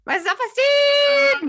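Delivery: high, loud, drawn-out wail; mock exultant cry. Host hamming up her boosted self-esteem, high loud cry. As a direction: belt it out in hammed-up triumph.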